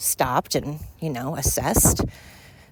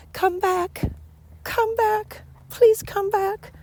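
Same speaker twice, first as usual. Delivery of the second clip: much higher, slow pleading call; calling sweetly; much slower than usual. Acted. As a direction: coax the birds back in a high sing-song. Calling to missing hummingbirds.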